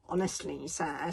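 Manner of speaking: lightly sneering, low-key complaint. Acted. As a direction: sneer mildly at the leader. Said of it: "sneered Sn-, Sockface Snotlight".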